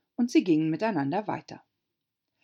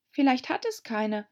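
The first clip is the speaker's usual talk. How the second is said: put-on a higher girl's voice daring a remark; Alice, cautious; 8 semitones higher than usual. A narrator's reading. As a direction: venture it timidly. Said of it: "wagte Alice zu bemerken". (Alice wagt einen Einwand, mädchenhaft)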